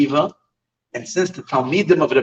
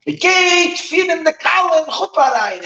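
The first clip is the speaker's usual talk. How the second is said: high, drawn-out cry of excitement; geschrei, ecstatic yell; 3 dB louder, slower than usual. Retold or imitated. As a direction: cry it out high, like a sudden rapturous call. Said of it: "he suddenly gives a geschrei, and he yells".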